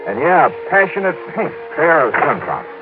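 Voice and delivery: crisp detective voiceover, telling the listener; steady first-person detective narration; story narration. A narrator's reading. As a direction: narrate it like a hard-boiled radio detective. Radio detective narrating his trip.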